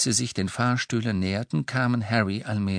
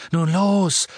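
high, loud go order; commanding; 9 semitones higher, 7 dB louder than usual. Acted. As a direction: move them out now. Harry leads out.

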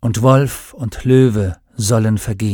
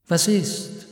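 suspicious murmur; 6 semitones higher, 5 dB quieter than usual. A narrator's reading. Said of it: "raunte der Schatten sofort misstrauisch".

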